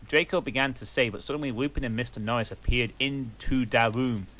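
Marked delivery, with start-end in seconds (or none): none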